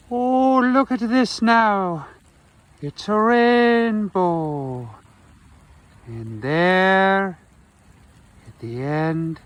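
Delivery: high, slow Irish leprechaun voice. Pretend. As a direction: play a gleeful leprechaun at a rainbow. Rainbow and pot of gold.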